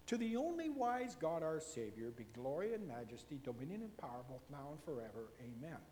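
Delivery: low, hushed doxology; reverent scripture recitation. Acted. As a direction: recite it softly and solemnly, sinking to a quiet amen.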